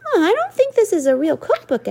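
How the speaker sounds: a mom gently skeptical; doubtful mom. A narrator's reading.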